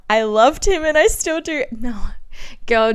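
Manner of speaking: usual speaking voice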